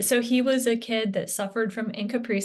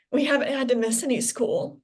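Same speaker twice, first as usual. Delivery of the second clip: higher, halting, fighting back tears; choked up; 5 semitones higher than usual. Real, not acted. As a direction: get choked up as you say it. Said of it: "I'm gonna get choked up".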